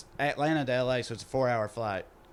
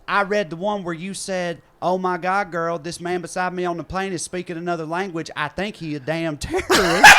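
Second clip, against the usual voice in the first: accusing; 4 semitones higher, 7 dB louder than usual. Retold or imitated. Woman behind quotes the text.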